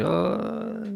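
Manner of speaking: high, startled little oh; taken aback oh. Retold or imitated. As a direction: react as if caught off guard. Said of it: "that I was like".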